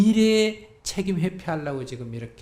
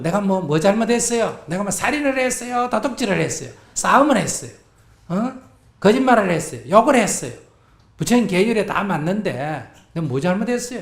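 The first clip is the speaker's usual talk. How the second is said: loud, higher defiant protest; brazen indignant; 6 semitones higher, 10 dB louder than usual. Shown as a demonstration. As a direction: shamelessly demand what you did wrong. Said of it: "이렇게 좀 뻔뻔스럽게 나가". (뻔뻔하게 큰 소리로 따지듯)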